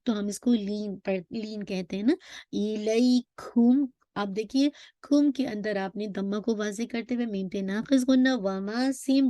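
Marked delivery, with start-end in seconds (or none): 2.5-3.9 इलैकुम chanted with ऐ held for two counts; leen vowel. Shown as a demonstration. Teacher repeats the leen example, slow chant. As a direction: hold the ऐ in इलैकुम. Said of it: "याद रखिए लीन के दो letters हैं".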